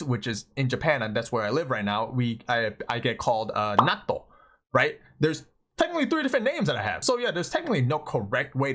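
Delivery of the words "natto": NATTO with a doubled T held briefly, then "oh"; Japanese-style Natto; Japanese pronunciation. Shown as a demonstration. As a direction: say Natto the Japanese way, "naht-toh". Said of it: "and then in Japanese".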